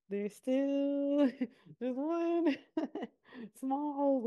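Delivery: slow words broken up by real giggles; laughing through it while teasing the secret; giggling. Real, not acted. Host teasing listeners about a hidden secret, giggling slowly.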